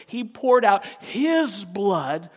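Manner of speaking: loud, high, slow cry; impassioned sermon shout; loud preaching. Acted. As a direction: shout it from the pulpit.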